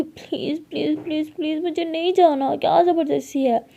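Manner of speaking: high, begging pleas; pleading whine. A narrator's reading. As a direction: plead like a stubborn child. Lily अंदर जाने से मना करती. (Lily ज़िद करके ऊँची आवाज़ में गिड़गिड़ाती है)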